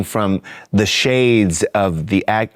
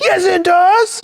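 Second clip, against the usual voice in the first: put-on indignant kid; slower than usual. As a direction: insist like an upset little boy. Kid insists to mama.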